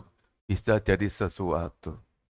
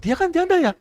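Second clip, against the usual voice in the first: much higher, sneering doubtful gossip; mocking gossip; 4 dB louder than usual. Relatives gossiping doubtfully about the widow, high sneering voice. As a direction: scoff at the widow's hopes. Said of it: "Orang lain bersaudara kata".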